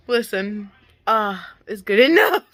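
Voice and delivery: chuckling that it will do; laughing. Real, not acted. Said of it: "laughing after skipping scream".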